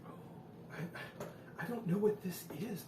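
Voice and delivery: normal talking voice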